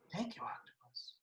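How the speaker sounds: very soft, breath-only thank-you; barely voiced, polite little thanks; meek thanks. A narrator's reading. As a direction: say it tiny and hushed. Boy's meek thanks to octopus.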